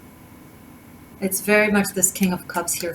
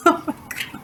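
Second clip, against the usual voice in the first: surprised laugh; 10 semitones higher, 5 dB quieter than usual. Real, not acted. Reader laughs at the cards.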